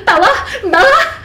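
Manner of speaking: high, loud mock-frantic shout; hammed-up mom calling in alarm; panicky mother. Mother as Bella nears the road, mock panic. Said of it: "and she's like".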